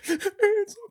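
very high, slow, tearful squeak; mock crying. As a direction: weep it as if overcome, hammed-up. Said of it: "Board members are crying".

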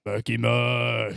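slow, drawn-out zombie moans; arguing zombies. Acted. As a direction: groan each name like a bickering zombie. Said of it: "these two zombies staring at each other".

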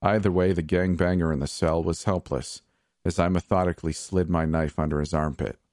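usual speaking voice

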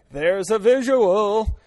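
loud, slow there's a visual; disgusted aside. Real, not acted. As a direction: draw it out, grossed out. Reacts to own gross image.

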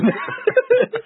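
very high squealing laugh; helpless laughter. Real, not acted. Cracking up at Dafoe's accent, squealing laughter. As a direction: lose it completely, squealing with laughter.

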